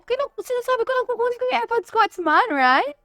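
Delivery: sassy retold comeback. Retold reply to arguing brother.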